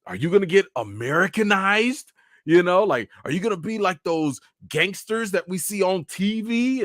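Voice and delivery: questioning in an imitated anxious parent voice; worried parents, imitated. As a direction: fret like a suspicious immigrant parent. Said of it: "they are worried that".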